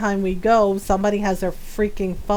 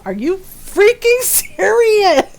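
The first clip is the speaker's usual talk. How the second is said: loud, slow, disbelieving exasperation; exasperated outburst; 9 semitones higher, 5 dB louder, much slower than usual. Retold or imitated. Ron fed up with the phone lady, loud exasperated outburst. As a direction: drag it out, fed up.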